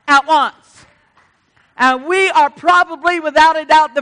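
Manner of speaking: loud, high preaching at full force; full-force revival preaching; fiery preaching. Real, not acted.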